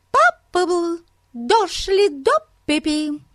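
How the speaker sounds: piping high foreign gibberish; high piercing voice. Acted. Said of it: "высоким пронзительным голосом".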